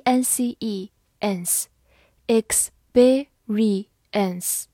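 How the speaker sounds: letters and sounds drawn out slowly; slow phonics build-up; very slow spelling. Shown as a demonstration. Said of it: "字母E发短音E，R I re，E".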